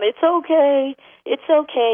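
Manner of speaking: high, loud mock soothing; hammed-up owner calming the dog. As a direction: coo it like a reassuring owner. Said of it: "You can tell 'em".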